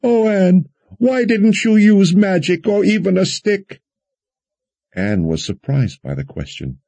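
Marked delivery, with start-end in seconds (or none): 0.0-3.8 shrill, distraught high voice. A narrator's reading. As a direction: wail it loud and very high. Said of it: "Alessandra's shrill voice".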